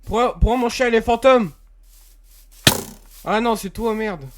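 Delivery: high, panicked questions. Real, not acted. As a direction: freak out at the ghost dog. Sees ghost dog, panics.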